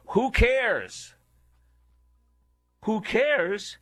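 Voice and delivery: high, slow, sneering who cares; a mocking, drawn-out who cares. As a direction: toss it off like you never cared. Mocking the senator's quote.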